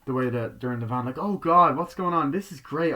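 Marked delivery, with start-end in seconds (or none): 1.2-3.0 high, gushing exclamation; mock excitement. Girl in the van reading the script, mock excitement. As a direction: gush it in a raised, lifted voice.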